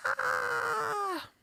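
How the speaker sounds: short high yelp on a breath; a sudden yelp as the hand hits something; startled cry. Real, not acted. Streamer knocking her microphone mid-stretch, short high startled yelp.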